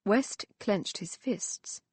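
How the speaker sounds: ordinary delivery, nothing marked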